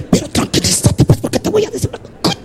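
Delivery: whispered, hushed pleading to calm down; whispered argument. Acted. Husband to wife in bed while kids sleep, whispered. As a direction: keep it under your breath.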